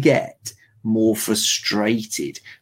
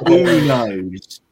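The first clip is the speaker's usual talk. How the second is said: louder, lower mock Brummie; Brummie accent; 3 semitones lower, 5 dB louder than usual. Playing up the stupid voice for the co-host, in a lower Brummie accent. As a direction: repeat it in a low, silly Brummie voice. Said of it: "you do a Brummie accent".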